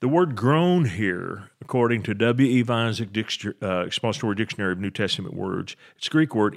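usual speaking voice

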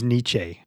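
Nietzsche said "NEE-chay"; niche as "neechay". Shown as a demonstration. Teasing co-hosts over a word, Nietzsche said "neechay". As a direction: joke ending in a long ay on Nietzsche. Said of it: "I think it's Nietzsche".

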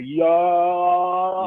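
loud drawn-out cry of suspense; retold yell. Viewer reacting to the slow speed chase, drawn-out yell.

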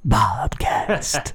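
drawn-out airy whisper; whispered show title. Pretend. Host finishing the podcast's title, stage whisper. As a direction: whisper it slowly after the big hoot.

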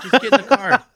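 high, loud retold plea; urgent wife. Imitating wife pulling husband from fight, high and urgent.

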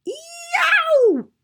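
high, loud yowl of pain; piercing cartoonish scream; wolf's scream. A narrator's reading. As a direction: yelp as if scalded. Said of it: "leapt up with a scream".

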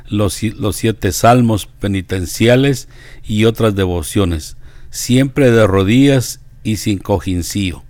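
measured devotional biography narration; deliberate reading with pauses between phrases; reading aloud. Acted. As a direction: read it out clearly, phrase by phrase. Reads a saint's life aloud.

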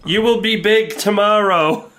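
high, loud booming pronouncement; fortune teller. Acted. Fortune teller answers after another quarter, hammed-up high proclamation. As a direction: proclaim it like a fortune-telling machine. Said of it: "Then the fortune teller goes".

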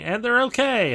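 high squeaky critter line; Phil the Mole voice. Retold or imitated. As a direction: pitch way up for a tiny mole. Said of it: "Phil the Mole saying".

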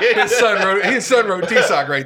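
loud, high laughing reveal. Real, not acted. Speaker laughing as he reveals the answer to friends, laughing loudly. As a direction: crack up while naming it.

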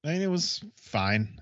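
normal talking voice

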